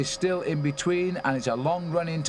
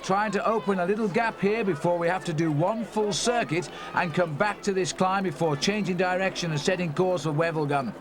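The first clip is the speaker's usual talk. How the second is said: usual speaking voice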